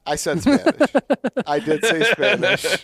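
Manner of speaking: laughing protest, high and loud. Real, not acted. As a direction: insist through laughter. Defending himself laughing.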